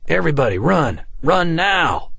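loud, high call to run; urgent shout. A narrator's reading. Officer ordering everyone to run, urgent shout. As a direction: drive the crowd to flee. Panicked crowd warning.

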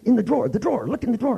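rising high, urgent repeats; hammed-up frantic pointing; mock alarm. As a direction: pretend panic to play along. Playing along with paranoia.

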